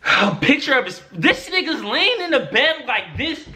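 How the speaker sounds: high, laughing disbelief. Real, not acted. Laughing while telling friends about the photo he got. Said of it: "laughing while telling it".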